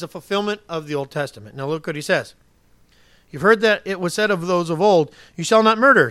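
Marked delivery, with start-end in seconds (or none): 3.3-6.1 louder, forceful reread of the verse; emphatic reading. Shown as a demonstration. Pastor rereads the verse to his congregation, louder and emphatic. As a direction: drive the commandment home.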